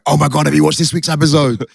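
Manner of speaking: high, eager fan gush; excited gossip. Retold or imitated. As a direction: gush like a fan bursting to share. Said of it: "I used to love going".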